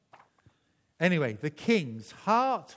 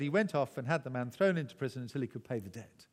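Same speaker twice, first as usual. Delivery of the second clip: ordinary talk, nothing marked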